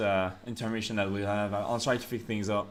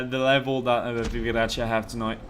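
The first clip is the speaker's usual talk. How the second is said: ordinary talk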